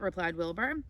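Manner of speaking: plain reading voice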